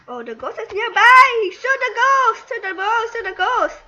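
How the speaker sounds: high, loud commands to shoot; urgent shouting. Real, not acted. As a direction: yell at teammates to shoot the ghost. Ghost nearby, urgent shouting.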